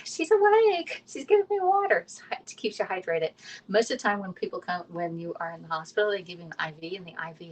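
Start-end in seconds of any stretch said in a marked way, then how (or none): 0.0-2.0 high squeaky organ voice; organs talking. Pretend. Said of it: "activates all of your organs on the inside saying".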